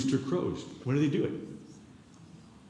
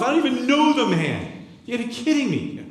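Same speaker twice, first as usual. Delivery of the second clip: much higher and louder, heated; loud high indignant denial; angry oath of denial; 12 semitones higher, 7 dB louder than usual. Acted. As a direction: swear it off furiously. Peter denying with an oath.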